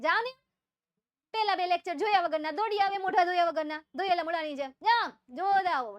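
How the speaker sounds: loud exasperated scolding. Real, not acted. Teacher scolding students who skipped earlier lectures, loud and annoyed. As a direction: tell them off, loud and fed up.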